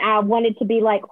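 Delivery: usual speaking voice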